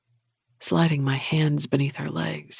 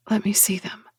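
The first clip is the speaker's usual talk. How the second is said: whispering; 5 semitones higher than usual. Acted. Heroine whispers to him.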